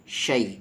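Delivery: شی as Arabic "shay" with a clear y glide; Arabic diphthong. Shown as a demonstration. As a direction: let شی glide from a into y. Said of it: "یہاں پر شی پڑھنا ہے". (شی عربی انداز میں، یا واضح)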